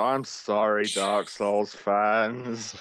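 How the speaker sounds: put-on very slow, dragged-out drunken apology; tipsy apology. Reviewer apologising to fans on New Year's Eve, mock drunk. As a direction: imitate a slightly drunk man saying sorry. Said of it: "clearly a little bit drunk".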